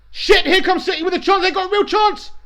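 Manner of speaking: high, loud burst of alarm; excited commentary. Real, not acted. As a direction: shout it as the attack breaks. Live commentary as City attack.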